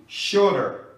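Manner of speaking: shooter with the T flapped like an R, said shoot-er; flapped T demo. Shown as a demonstration. Teacher showing the American flap T, clear demo. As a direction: say shooter as shoo-der with a quick tap. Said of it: "onde o T passa a ter som de R".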